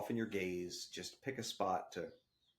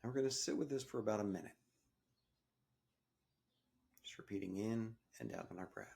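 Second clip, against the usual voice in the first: soft, calm meditation guide voice; guided meditation; 5 dB quieter than usual. Acted. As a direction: ease the listener into the breath, gently. Leads a breathing meditation.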